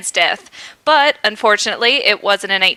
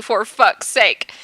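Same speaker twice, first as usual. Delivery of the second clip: slow, a touch of held-back tears; on the verge of tears; much slower than usual. A narrator's reading. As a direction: drag it out like he might cry. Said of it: "He sounded on the verge of tears".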